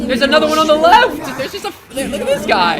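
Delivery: loud, high, laughing excitement; excited shout. Real, not acted. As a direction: yell it out in disbelief. Excited reaction to game creature.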